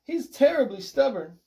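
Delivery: high, fretful complaint; lamenting. A narrator's reading. Owner about his cat up a tree, lamenting. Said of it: "Mister Sims lamented".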